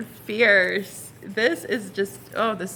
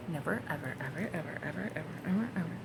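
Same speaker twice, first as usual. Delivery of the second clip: hushed emphasis; 3 semitones lower, 11 dB quieter, faster than usual. Pretend. Reader promising the viewer, evers fading to a hush. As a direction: whisper the evers for effect.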